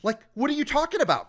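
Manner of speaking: high, incredulous challenge; exasperated. Acted. Acted exasperated retort to the cops.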